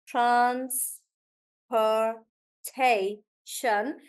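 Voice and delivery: transportation drawn out very slowly in four beats; syllable by syllable. Shown as a demonstration. Teacher breaking down a long word, very slow syllabled transportation. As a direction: stretch each syllable of transportation, with gaps.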